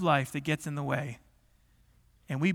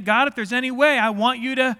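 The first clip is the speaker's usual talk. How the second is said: raised, higher plea to God; imitated crying out; 5 semitones higher, 6 dB louder than usual. Preacher voicing Daniel's prayer to God, crying out. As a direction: call up to God in earnest appeal. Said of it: "he was crying out".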